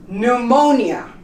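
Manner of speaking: Pneumonia starting on N; silent P. Shown as a demonstration. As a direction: drop the P and begin Pneumonia with N. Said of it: "You don't pronounce the P".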